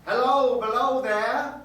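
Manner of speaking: loud, high, slow ghostly hail; yell out. Retold or imitated. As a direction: shout down the line from far above. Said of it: "a voice yell out".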